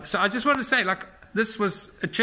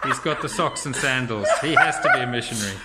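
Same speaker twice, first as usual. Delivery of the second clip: laughing; 3 semitones lower than usual. Real, not acted. Teasing about socks and sandals.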